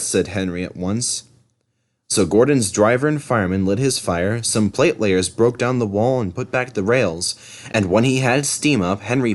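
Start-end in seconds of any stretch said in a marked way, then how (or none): none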